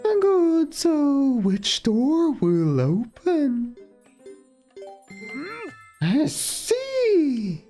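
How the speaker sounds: high, slow mystic fortune teller. Pretend. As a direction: intone it like a theatrical seer. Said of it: "Fortune telling".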